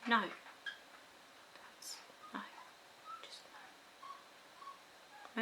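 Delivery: soft breathy whisper saying no; whispered refusal. Retold or imitated. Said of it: "I'm like".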